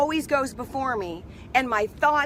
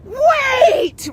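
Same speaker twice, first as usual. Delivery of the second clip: much higher, loud yell of "Wait!"; screamed wait; 13 semitones higher, 8 dB louder than usual. Retold or imitated. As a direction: scream it to stop the closing door. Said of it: "she screams at the top of her lungs".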